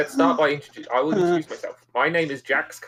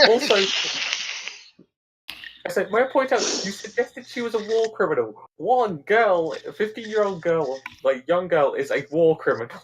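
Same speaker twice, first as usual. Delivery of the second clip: indignant protest; 6 semitones higher than usual. Acted. Arguing with the other player.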